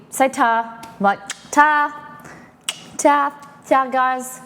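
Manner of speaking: put-on high, sing-song ta to a toddler; talking to a kid. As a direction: coax a little kid, bright and high. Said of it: "you say to a kid".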